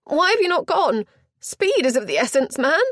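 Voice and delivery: high, loud, fast frantic lady; a flustered chaperone insisting she be heard; high loud and hurried, close to panic; agitated duenna. Acted. Duenna scolds the butler.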